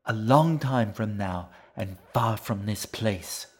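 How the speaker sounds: put-on the fox warns she will flee with the boy's will; sly fox threatening.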